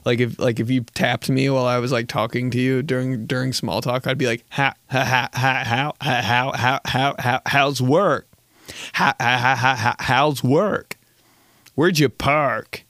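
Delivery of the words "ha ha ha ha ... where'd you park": stuttering, looping fake laugh, slowing at the end; like a skipping soundboard button; glitching soundboard voice. Comedian playing himself as a broken small-talk soundboard. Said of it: "It's always a soundboard voice".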